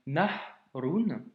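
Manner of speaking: Nahrun said slowly, letter by letter; arabic word. Shown as a demonstration. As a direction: spell out Nahrun syllable by syllable for a learner. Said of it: "la fameuse lettre du jour avec absence de voyelle". (Nahrun articulé lentement)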